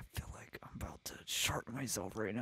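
urgent breathless whisper. Retold or imitated. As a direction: lean in and whisper the emergency. Secretly telling a friend mid-show.

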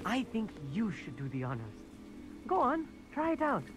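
very soft, hushed invitation; hushed offer. Acted. Stargazer lets companion go first, hushed. Hands the telescope over to companion.

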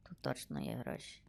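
very soft, low whisper; secretive whisper. Real, not acted. Player eyeing a gas station to rob, whispering. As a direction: whisper it to yourself.

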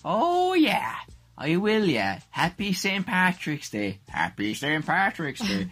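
put-on high, slow stage-Irish accent; hammy over-the-top Irish accent; comedy Irish accent. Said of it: "different sentences in comedy Irish accents".

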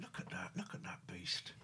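whispered fond words; whispering. Real, not acted. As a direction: whisper lovingly to the pet. Whispered to the cat.

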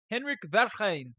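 Henrik's name in a put-on German accent. Streamer greeting viewer Henrik, German accent. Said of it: "that was like a German accent".

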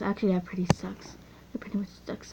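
muttered whispered aside; whispering. Real, not acted. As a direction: whisper it off-mic. Aside about own trade offer.

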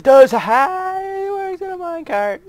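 high, loud cheering; excited shout. Real, not acted. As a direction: yell it out in triumph. Fix finally works, cheering.

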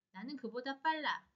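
ordinary delivery

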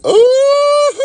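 very high loud shrieking ooh into a laugh; excited shriek. Real, not acted. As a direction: squeal with delight then laugh. Greeting Stephanie excitedly.

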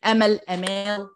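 أمل short then أمال with long aa; long aa contrast. Shown as a demonstration. Teacher contrasting أمل with long vowel أمال. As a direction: stretch the aa in أمال. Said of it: "try to distinguish".

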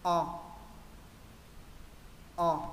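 short O with a wide-dropped jaw; short open O. Shown as a demonstration. Teacher models the first O, short and wide open. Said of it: "hạ rộng hơn những chữ bên trên".

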